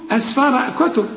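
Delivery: usual speaking voice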